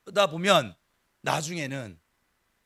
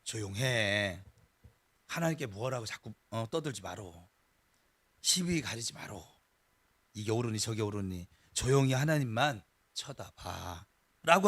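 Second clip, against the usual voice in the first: Chungcheong dialect; 9 semitones lower, 4 dB quieter than usual. Retold or imitated. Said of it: "충청도 말이 그때 배워져가지고".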